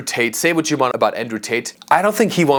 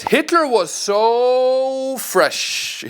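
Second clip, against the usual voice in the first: slow, drawn out and much higher, like a starstruck fan; mock gushing quote; 11 semitones higher, much slower than usual. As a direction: stretch every word out in mock admiration. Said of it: "He says".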